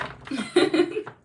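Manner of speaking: high, loud giggle burst; amused laugh. Real, not acted. Amused by a chat name.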